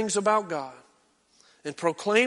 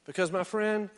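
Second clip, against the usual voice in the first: ordinary delivery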